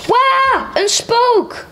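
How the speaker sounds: put-on high, excited little-boy "wow, a ghost"; little boy crying out. Reader voicing a little boy who spots a ghost, high excited cry. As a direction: squeal it like a small startled kid. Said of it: "riep een klein jongetje".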